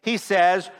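ordinary talk, nothing marked

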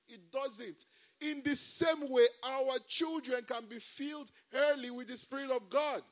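high, loud, fervent pulpit delivery; rousing sermon voice, building to shouts of praise; preaching at full force. Acted. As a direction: preach it out to the whole church. Pastor preaching to congregation.

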